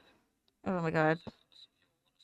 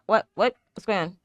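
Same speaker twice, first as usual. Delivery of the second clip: usual speaking voice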